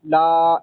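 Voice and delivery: single blended syllable ला, letters not named; letters wrongly joined. Shown as a demonstration. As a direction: say लाम and अलिफ merged as ला. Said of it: "ऐसे नहीं पढ़ना इनको". (लाम-अलिफ को जोड़कर ला)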